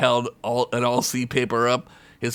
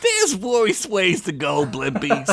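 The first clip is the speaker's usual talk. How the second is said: high, easygoing goblin; carefree high goblin; goblin shrug; 11 semitones higher, slower than usual. Acted. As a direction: goblin brushing it off.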